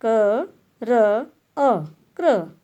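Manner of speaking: क, र, अ slowly, then joined as क्र; sounding out. Shown as a demonstration. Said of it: "तो इसमें क्या बोला".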